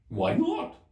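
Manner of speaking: high, loud snappy retort; March Hare voice. Acted. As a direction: toss it back loud and high. The March Hare's voice.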